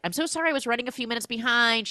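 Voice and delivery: high, fast mock apology; rushed mock sorry to the waiting class; flustered apology. Teacher rushing an apology to her class.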